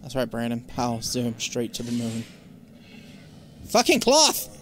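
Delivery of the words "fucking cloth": angry shout. Real, not acted. Frustrated in game.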